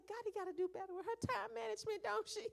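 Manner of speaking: put-on aunt admitting fault to the kid in a high, teasing voice; playful self-mocking reply. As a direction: tease yourself in a high voice. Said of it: "I was like".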